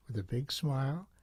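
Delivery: usual speaking voice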